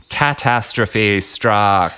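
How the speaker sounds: slow, drawn-out storyteller's build; dramatic reveal. Acted.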